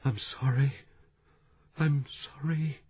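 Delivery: moaning whisper. A narrator's reading. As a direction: whimper it out through sobs. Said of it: "Blackie was moaning and whispering".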